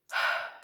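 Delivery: long breathy sigh before confessing; heavy sigh. Pretend. Apologizer braces for a big one, heavy sigh. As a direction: let out a big, burdened sigh.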